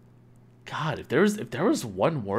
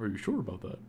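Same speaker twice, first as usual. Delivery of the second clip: soft, slightly lowered mock doubt; silly lowered voice; 10 dB quieter, much faster than usual. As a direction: lightly put on a silly questioning voice. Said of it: "what's that voice".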